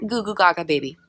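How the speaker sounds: lightly voiced baby babble; half-hearted impression of a baby; mock baby talk. Speaker showing being super little, playful baby voice. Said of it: "that's my impression of a baby".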